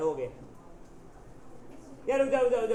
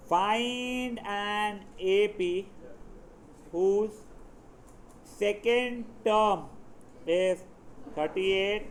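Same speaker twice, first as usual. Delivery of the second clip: very slow, drawn-out dictation; dictating a question; much slower than usual. Real, not acted. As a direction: dictate piece by piece to the class, with pauses. Composes question aloud for class.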